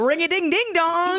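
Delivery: high, loud mock sing-out; hammed-up eccentric greeting; cuckoo opener. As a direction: draw it out high like a ridiculous chat-up line. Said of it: "cuckoo, eccentric openers".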